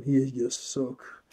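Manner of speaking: slow, hushed, overcome; barely holding it together; moved, slowed down. Real, not acted. Telling viewers the scene that gets him, slow and choked up. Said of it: "Sorry, I can't".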